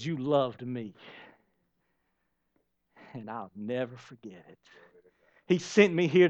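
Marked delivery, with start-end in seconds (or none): 0.0-4.6 tender whisper-soft. Acted. Jesus tenderly speaks to listeners.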